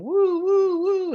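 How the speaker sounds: high, loud "woo woo" siren; imitated alarm siren. Host reassures tellers about the timer, mock siren wail. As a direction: mimic a timer alarm going off. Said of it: "It won't suddenly start going".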